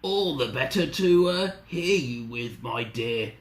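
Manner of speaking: slow croaky fake Granny, falling away; croaking wolf. Wolf in Granny's bed to Little Red, croaking. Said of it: "croaked the wolf".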